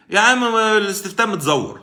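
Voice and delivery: loud, high scoffing voice of a doubter; mocking skeptical viewer. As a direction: scoff it like a cynic in the chat. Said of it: "تلات ارباع اللي على اللايف هيقول لك ايه". (تقليد ساخر لمتابع مشكك بصوت عالي)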